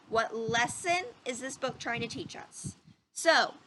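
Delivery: ordinary delivery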